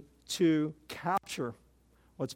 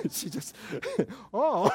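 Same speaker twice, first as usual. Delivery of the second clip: laughing; 5 semitones higher, slower than usual. Real, not acted. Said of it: "she laughs at junkie line".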